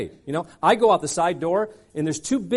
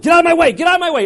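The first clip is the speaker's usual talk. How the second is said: high loud yelling; panicked yell; 10 semitones higher, 6 dB louder, much faster than usual. Retold or imitated. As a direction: shove through the crowd yelling. Fleeing through crowd.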